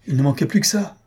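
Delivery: spring up in exasperation; exaspération bondissante. A narrator's reading. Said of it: "en bondissant comme un tigre". (exclamation exaspérée, plus aiguë)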